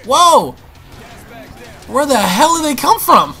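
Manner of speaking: high, loud burst of surprise; startled. Real, not acted. Gamer surprised by enemies appearing, startled. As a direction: blurt it out, caught off guard.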